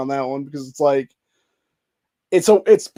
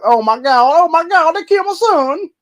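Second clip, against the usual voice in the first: high, louder hammed-up fake wail; mock wailing mother; 7 dB louder than usual. Vlogger parodying the mother's fake grief, shrill mock wail. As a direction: ham up a fake grieving scream, way up high.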